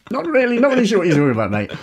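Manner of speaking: high, rapid mock reply; mock denial. Speaker retelling their reply to an American, high rushed denial. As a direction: rattle it off high and fast. Mimicking own awkward reply.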